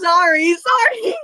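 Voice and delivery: high, loud, squealing sorry; a playful high-pitched sorry; squealed apology. Real, not acted. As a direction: squeak it out in mock guilt. Mock apology, high cry.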